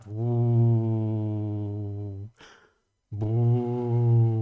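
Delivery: hummed woo imitating the film score; a self-mocking attempt at the score's horns; bad soundtrack impression. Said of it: "that's a shit impression".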